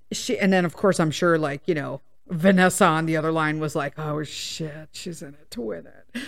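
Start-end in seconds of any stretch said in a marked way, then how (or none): 4.0-6.2 much softer, lower mock whisper-aside; imagined hushed aside. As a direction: mutter it like a worried agent on the other line.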